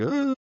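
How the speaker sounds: high, short mock groan of despair; exasperated groan. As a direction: let out a sharp groan of dismay. Overwhelmed after a crying phone call.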